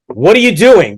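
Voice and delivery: high, loud booming demand; big booming voice. Retold or imitated. Rabbi catching a student brushing his hair, booming voice. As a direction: boom it out like an intimidating teacher. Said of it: "He has this big booming voice".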